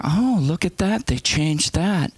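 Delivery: high, put-on old-lady marvelling; high mock wonder; passive aggressive mother. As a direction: imitate mom making pointed little observations. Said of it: "then she'd go down".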